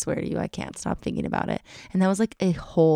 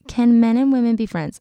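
quoted question; 6 semitones higher, 7 dB louder than usual. Retold or imitated. Quotes someone's question to her.

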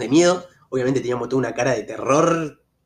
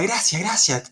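raised-pitch imitation of near-crying gratitude; mock tearful thanks; 6 semitones higher, much slower than usual. Tennis player still thanking the driver, mock tearful. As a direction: thank him as if nearly in tears. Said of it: "pero poco más lloraba".

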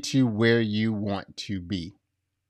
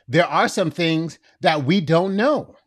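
ordinary delivery

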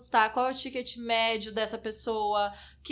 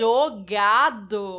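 "jogado" stretched very slow and loud; indignant emphasis; 8 dB louder, much slower than usual. Retold or imitated. Guest quoting the insult to her Instagram, indignant stress on "jogado". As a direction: draw out "jogado" loudly in mock outrage. Indignantly repeats the criticism she received.

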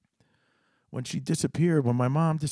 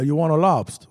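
louder repeat; 3 semitones higher, 7 dB louder than usual. Retold or imitated. Dad repeats offer, louder.

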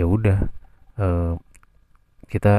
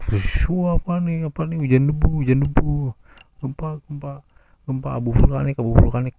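panicked shouts; 7 semitones higher than usual. Retold or imitated. Dust rains on the class.

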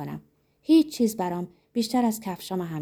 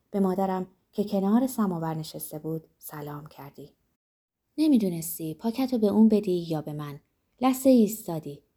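plain reading voice

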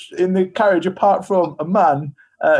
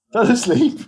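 laughing; 5 semitones higher than usual. Real, not acted. Laughing while telling the mishap.

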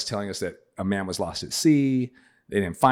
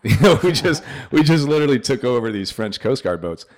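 laughing; 5 semitones higher, 8 dB louder than usual. Real, not acted. Laughing while telling the story.

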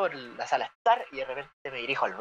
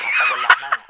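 laughing; 8 dB louder than usual. Real, not acted. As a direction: burst of laughter, high and loud. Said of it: "laughs at own boast".

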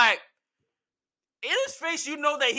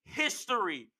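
usual speaking voice

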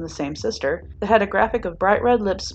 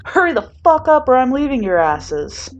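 yelling; 6 semitones higher, 6 dB louder than usual. A narrator's reading. Bakugo yelling from entrance.

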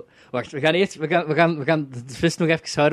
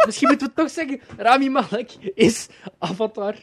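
laughing; 8 semitones higher than usual. Real, not acted. Still laughing about the joke.

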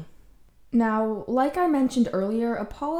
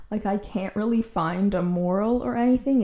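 usual speaking voice

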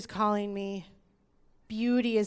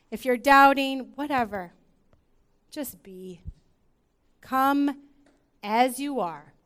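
louder, higher worship invitation; impassioned preaching; 4 semitones higher, 5 dB louder than usual. Acted. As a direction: urge them to come as they are. Worship call.

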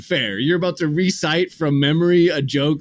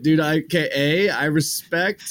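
ordinary talk, nothing marked

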